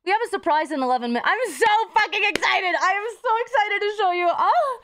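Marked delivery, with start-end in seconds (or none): 1.3-4.8 can barely contain the excitement; real giddy excitement, rising high; excited. Real, not acted. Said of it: "I'm very excited".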